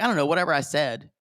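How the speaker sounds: rushed, dismissive mumble; fast careless apology. Retold or imitated. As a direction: toss it off quickly. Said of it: "he's like".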